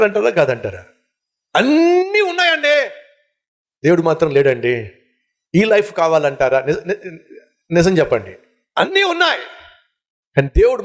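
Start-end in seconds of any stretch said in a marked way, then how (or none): 1.6-2.9 high exclamation. Real, not acted. Preacher's high emphatic exclamation. 8.8-9.4 high exclamation. Real, not acted. Same emphatic line repeated.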